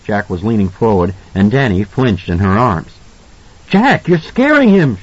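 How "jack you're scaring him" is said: sharply raised pitch in reproach; high, accusatory. A narrator's reading. Wife telling husband he's scaring their son, accusatory. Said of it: "her voice was high, accusatory".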